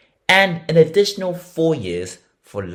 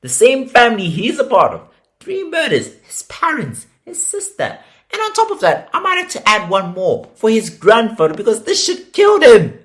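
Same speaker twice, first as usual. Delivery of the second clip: much higher, loud furious outburst; 8 semitones higher, 7 dB louder than usual. Real, not acted. Host raging at the killer over his murdered family, furious and high. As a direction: let the anger boil over.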